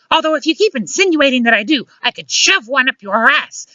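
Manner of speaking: high-pitched scolding woman; indignant comeback. A narrator's reading. Luciana objects to being called a witch, indignant comeback. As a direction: threaten him in a high, offended voice.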